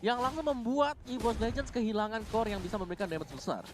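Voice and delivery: high, loud hyped commentary; excited play-by-play. Acted. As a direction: sell the big play with energy. Co-caster hypes the team fight.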